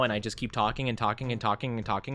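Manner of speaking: rushed run-on without a breath; a fast breathless ramble; rambling fast. Shown as a demonstration. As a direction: rattle it off breathlessly. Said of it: "I don't take a breath like I am right now".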